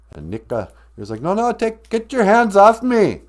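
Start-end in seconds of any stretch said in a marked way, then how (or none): 1.2-3.2 high, loud squawking protest; hammed-up high-pitched yell; panicked protest. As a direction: shout it high, fighting off a grab. Goose resisting being grabbed.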